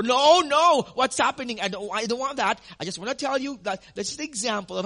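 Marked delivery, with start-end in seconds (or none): 0.0-1.6 a hammed-up panicked cry of alarm; mock hysteria. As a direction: play the frightened congregant crying out. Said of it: "cause here some hysteria".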